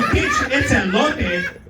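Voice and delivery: slow, high, laughing through the line; laughing retort. Retold or imitated. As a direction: drag it out while cracking up. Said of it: "I tell them".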